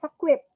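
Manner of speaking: "equip" with the weight on QUIP; stress on second syllable. Shown as a demonstration. Teacher confirming the class's answer, second-syllable stress on "equip". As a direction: lean on the end of "equip". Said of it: "it's already on the second syllable".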